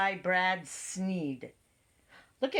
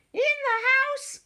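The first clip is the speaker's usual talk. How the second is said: very high, louder squeak; squeaky mouse; 5 dB louder than usual. A narrator's reading. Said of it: "squeaked the mouse".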